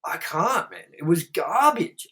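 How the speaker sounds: ordinary talk, nothing marked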